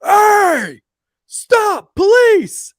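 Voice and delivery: police shout. Retold or imitated. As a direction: bark it like an officer stopping a car. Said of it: "And I go".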